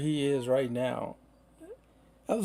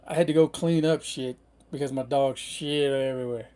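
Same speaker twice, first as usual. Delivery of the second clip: usual speaking voice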